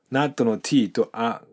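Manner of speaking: ordinary delivery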